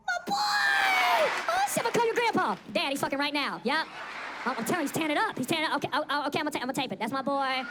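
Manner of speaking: high, loud thrilled yelling; overjoyed mock-proud father; proud dad shout. Dad finding his son with a girl: loud, high, thrilled yelling.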